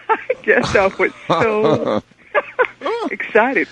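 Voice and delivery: laughing through it. Real, not acted.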